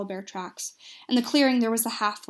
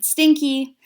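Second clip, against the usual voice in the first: raised, higher narrated shout; booming shout; 5 semitones higher, 8 dB louder than usual. A narrator's reading. Half-orc cleric booms at the halfling in the bushes, shouting. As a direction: call it out loud, mid-story. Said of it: "to boom her voice as she shouted".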